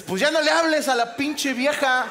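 high, loud, laughing take on a clueless guy; hammed-up boyfriend giving bad advice; mock clueless boyfriend. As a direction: blurt it out like a dumb boyfriend. Man's blunt advice, acted quote.

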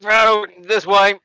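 calling out directions; eager guard voice. Acted. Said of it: "And he's like".

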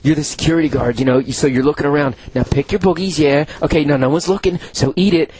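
low, patient coaching voice; imitated director instructions. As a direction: talk an actor through it step by step. Acting out director coaching the actor.

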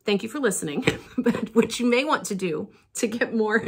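ordinary talk

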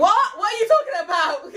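loud high disbelief; mock shout. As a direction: shout it back, laughing.

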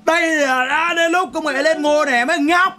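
high, loud, drawn-out announcement; hammed-up triumphant shout; mock proclamation. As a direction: proclaim it loudly and slowly, way up high. Same line repeated as a proclamation.

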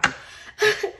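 breathy unvoiced laugh through tears; a high, airless laugh of being overwhelmed; teary laugh. Real, not acted. Tearful laugh over ice cream quote.

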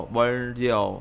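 ordinary delivery

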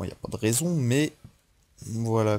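ordinary talk

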